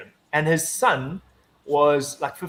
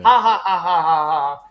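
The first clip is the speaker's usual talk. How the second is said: forced laugh; 5 dB louder than usual. Retold or imitated. Mock laugh in retold quote.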